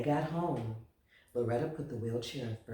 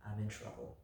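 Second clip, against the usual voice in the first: worried muttering; 3 semitones lower, 11 dB quieter than usual. A narrator's reading. Loretta fretting on the way home, softly. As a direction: mutter it under your breath. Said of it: "Loretta went home saying".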